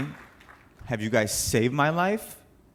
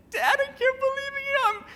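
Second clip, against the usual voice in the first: much higher, voice cracking with tears; tearful disbelief. Retold or imitated. Son telling his dad the news, crying with joy. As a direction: choke it out through happy tears.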